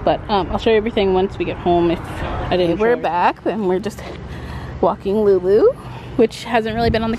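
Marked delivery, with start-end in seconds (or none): none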